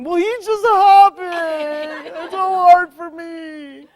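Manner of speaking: slow, high, teary whine; mock whinging. Healer imitating her client's whinging, slow, high and teary. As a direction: wail it like a child who won't climb. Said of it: "Well, what's Rabbit doing?".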